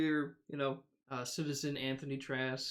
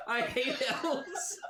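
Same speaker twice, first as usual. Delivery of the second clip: mock character quote; 11 semitones higher, much slower than usual. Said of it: "The first thing Eris has said".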